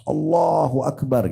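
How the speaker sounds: high, drawn-out mock battle cry; imitated shout. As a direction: call it out high and stretched, imitating excited companions. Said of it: "teriak dengan suara keras". (meniru teriakan takbir, tinggi dan panjang)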